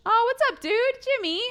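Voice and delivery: high, excited hello to a friend; thrilled to spot a pal; delighted greeting. Real, not acted. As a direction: greet them with a big rise in pitch. Delighted greeting of a known viewer.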